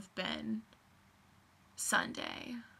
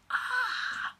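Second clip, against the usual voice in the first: short squeal, much higher and louder; a quick delighted shriek; high excited squeal; 6 dB louder than usual. Retold or imitated. Excited squeal after high five.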